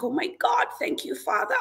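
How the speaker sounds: high-pitched, hushed thrill; super happy. Retold or imitated. Speaker reliving her prayer of thanks, super happy. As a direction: thank God in overjoyed, restrained excitement. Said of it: "I was super happy".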